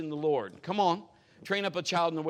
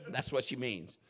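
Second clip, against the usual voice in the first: ordinary talk, nothing marked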